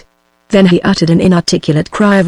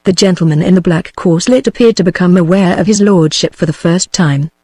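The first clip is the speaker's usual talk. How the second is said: usual speaking voice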